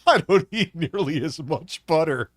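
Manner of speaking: laughing mockery of the quote; mocking while laughing. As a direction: laugh through a mocking repeat.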